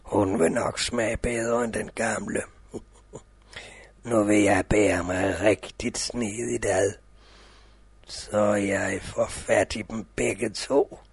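deep, slow scheming wolf; sly wolf. Acted. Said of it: "wolf plotting to himself".

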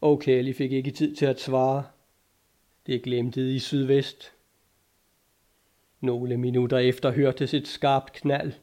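ordinary delivery, nothing marked

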